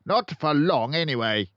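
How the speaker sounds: loud, high barking headmistress; booming tyrant headmistress; barking Trunchbull. A narrator's reading. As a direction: bark it out like a bully. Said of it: "Miss Trunchbull barked".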